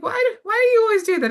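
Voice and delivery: high, loud, fast retold complaint; mock exasperation.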